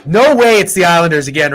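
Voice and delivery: ordinary talk, nothing marked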